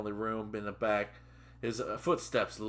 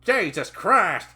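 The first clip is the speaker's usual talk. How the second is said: loud, high, drawn-out exclamation; angry yell; 8 semitones higher, 10 dB louder, slower than usual. Acted. Trainer exploding at his late trainee, angry yell. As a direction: yell it slowly in exasperation. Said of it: "Ray yelled".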